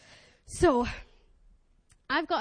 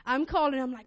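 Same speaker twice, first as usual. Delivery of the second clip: usual speaking voice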